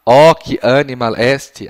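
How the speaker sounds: slow, loud, drawn-out phrase; slow full sentence. Shown as a demonstration. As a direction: spell it out for the child. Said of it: "a frase toda".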